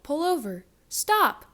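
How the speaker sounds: high, loud police holler. Acted. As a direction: yell like a sheriff in pursuit. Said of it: "sheriff hollered out".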